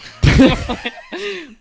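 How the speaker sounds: big shrieking laugh; cracking up loud and high. Real, not acted. Laughing at the commas.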